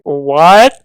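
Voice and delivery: loud, rising one-word outburst; mock shock. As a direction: act out a dad's stunned disbelief. Acts out dad's shocked reaction.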